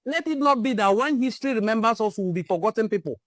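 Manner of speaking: ordinary delivery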